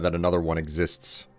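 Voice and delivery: ordinary talk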